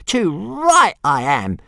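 high, slow grumpy little dragon. A narrator's reading. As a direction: drag it out in a huffy dragon voice. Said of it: "said Toothless grumpily".

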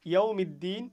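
ইয়াউমিদ্দিন with দি stretched three beats; long vowel pull. Shown as a demonstration. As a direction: hold the দি long. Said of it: "দিনে তিন আলিফ টান".

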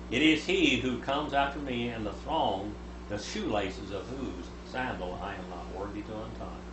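ordinary talk, nothing marked

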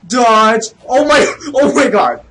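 high, loud startled cries; panicked shout. Real, not acted. Gamer trying to dodge an attack, panicked. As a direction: shout in a sudden game panic.

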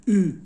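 او as a rounded front vowel, lips rounded on "ee"; Turkish U with dots. Shown as a demonstration.

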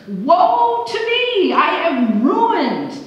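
slow, high, loud wail of despair; anguished wail. Acted. Preacher voicing Isaiah's despair, a drawn-out high wail. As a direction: stretch it out like a man undone. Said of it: "his response sort of confused me".

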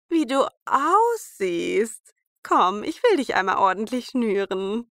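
put-on old woman. A narrator's reading. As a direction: sweet-talk her slyly. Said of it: "sprach die Alte".

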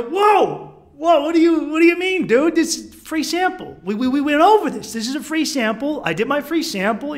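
high, loud, acted indignation; indignant protest. Tourist disputing the charge, indignant acted protest. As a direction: argue back, alarmed and insistent.

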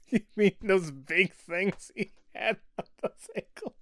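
line squeezed out through giggles; laughing while reading. Real, not acted. Reader failing to get the game line out, laughing. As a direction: try to read it while cracking up. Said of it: "You can't even say it without laughing".

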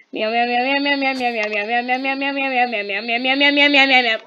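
loud frantic barrage of meows, building to a yell; screamed meowing. Pretend. Player fed up with background noise, screamed meowing. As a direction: scream meows to drown everyone out. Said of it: "Just screaming this in the mic".

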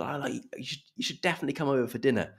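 polite gushing invitation. Retold or imitated. British acquaintance to visitor, politely effusive.

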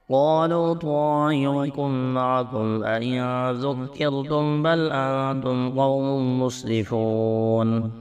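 ordinary delivery

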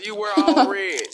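ordinary talk

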